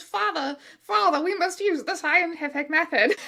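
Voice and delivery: put-on high prissy little-boy voice; a smug kid instructing his father; high, precocious child lecturing; annoying child genius. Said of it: "annoying child genius Harry talks like that".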